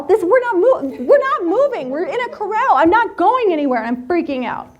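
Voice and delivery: high, loud, wavering panic on a horse; mock-panicked protest; panicked freak-out.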